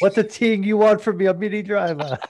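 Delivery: loud mock Jamaican patois; Jamaican accent. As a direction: ham up a Yardie voice. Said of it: "She's a Yardie".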